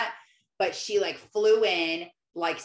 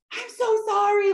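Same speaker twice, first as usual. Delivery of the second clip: high, loud mock apology; retold apology; 13 semitones higher, 8 dB louder than usual. Frazzled mom apologizing to a waiting parent, high imitated wail. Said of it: "She's like".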